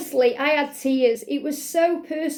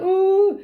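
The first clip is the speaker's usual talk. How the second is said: a hammed-up high loud "Ooh" sob; mock sobbing wail; 6 semitones higher, 6 dB louder than usual. Telling viewers how she did not cry, a mock loud sob. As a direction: fake a dramatic crying wail for effect. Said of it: "I don't mean crying like".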